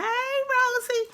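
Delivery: high, slow sing-song hello; sing-song greeting. Real, not acted. Crafter greeting fellow creator Rosie, high sing-song. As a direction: call out sweetly to a friend.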